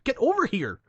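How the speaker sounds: high-pitched retold indignation; indignant protest. Driver protesting the charge to tow guy, indignant. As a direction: argue it back, voice pitched right up.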